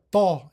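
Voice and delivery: "tha" as Arabic emphatic t, backed vowel; emphatic dental. Shown as a demonstration. Teacher showing the Arabic emphatic, pharyngealized "tha". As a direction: say "tha" with the throat tightened. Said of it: "Now the emphatic".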